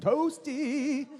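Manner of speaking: squeaky high "Toasty" call; high excited call. Acted. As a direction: pitch "Toasty" up high and bright. Repeating a shouted word.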